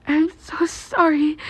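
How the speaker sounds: high, slow whispered apology; a young girl whispering sorry. Acted. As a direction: breathe it out, high and hushed. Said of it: "Yamamomo said suddenly".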